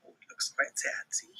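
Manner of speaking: hushed whispered aside; soft whisper aside. A narrator's reading. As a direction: whisper it to the kids. Narrator aside to listeners.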